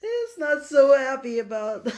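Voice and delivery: words bubbling through real laughter; trying to talk but cracking up; giggling mid-sentence; laughing. Real, not acted. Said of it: "I can't control myself laughing like this".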